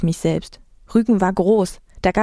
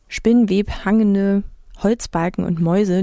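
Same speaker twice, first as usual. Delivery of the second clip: plain reading voice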